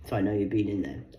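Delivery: ordinary delivery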